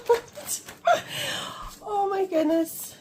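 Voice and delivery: high giggly laugh through the words; laughing at her own mistake; giggling. Real, not acted. Budgeter laughing at her counting slip, giggly.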